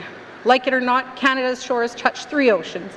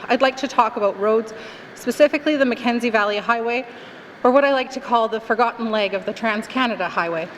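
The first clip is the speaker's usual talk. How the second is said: normal talking voice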